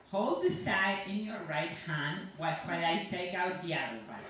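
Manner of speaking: ordinary delivery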